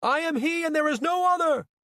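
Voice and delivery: high, booming proclamation; messianic cry. A narrator's reading. False Christ to the crowd from a truck, crying out. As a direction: proclaim yourself to the masses, very high. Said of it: "he cried".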